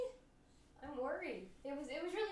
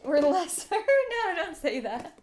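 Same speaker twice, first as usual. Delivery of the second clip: surprised; 6 semitones higher, 11 dB louder, slower than usual. Real, not acted. Echoing the remark in disbelief.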